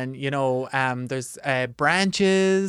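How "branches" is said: American twang. Shown as a demonstration. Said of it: "words that you can make American".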